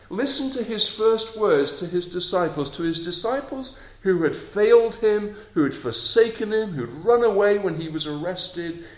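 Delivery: loud, high, impassioned preaching; preaching at full force. Acted. Preacher urging the congregation to think of God, rising to full force. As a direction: drive it home to the congregation.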